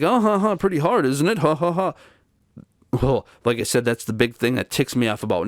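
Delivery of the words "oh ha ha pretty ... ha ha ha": high, laughing mock sympathy from the nurse. Nursing home staffer laughing off a desperate caller, mocking. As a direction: imitate her laughing at you, pitched up. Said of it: "And she's like".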